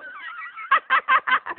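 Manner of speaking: high far mocking cackle; mocking girly laugh. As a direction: laugh in his face. Girl laughs at him.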